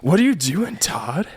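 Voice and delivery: high-pitched mock Californian; Californians sketch accent. Podcaster quoting the sketch at Todd, hammed-up Californian accent. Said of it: "what a great S N L sketch that is".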